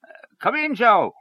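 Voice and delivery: high-pitched welcoming call. A narrator's reading. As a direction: call him in from the doorway. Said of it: "he called out".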